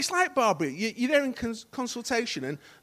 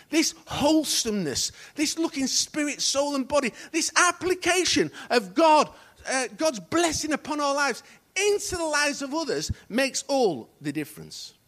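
raised voice driving through a list; emphatic preaching; 8 semitones higher, 7 dB louder than usual. Real, not acted. Preacher telling congregation what makes all the difference, emphatic preaching. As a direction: press each phrase home, then drop on the last.

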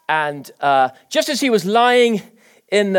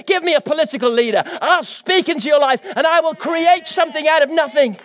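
full-force preaching; 7 semitones higher than usual. Acted. Preacher stirring the congregation, full-force preaching. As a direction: preach it out with conviction.

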